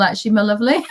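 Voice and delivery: usual speaking voice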